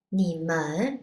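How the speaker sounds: ordinary talk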